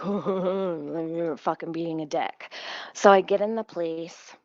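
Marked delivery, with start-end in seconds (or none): 0.0-2.5 slow, dragged-out imitation of the officer; mock cop insult. Imitating the cop in the car, very slow mock tone. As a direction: drawl it like the annoyed cop. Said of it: "He's like".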